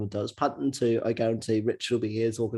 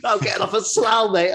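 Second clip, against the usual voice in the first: cheery bloke; 7 semitones higher, 5 dB louder than usual. Pretend. Acting the bear's chatty reply.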